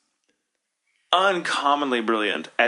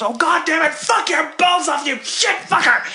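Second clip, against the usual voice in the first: mom's sudden road rage cursing; road rage outburst. Retold or imitated. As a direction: explode at the driver who cut her off. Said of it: "she just goes".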